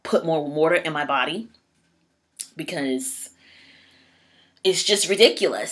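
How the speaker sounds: ordinary delivery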